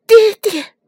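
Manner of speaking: put-on very high, loud child's call; tearful boy's cry. A narrator's reading. Nezha in tears can only call father, crying out. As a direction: cry out to father in a boyish pitch. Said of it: "只是叫着".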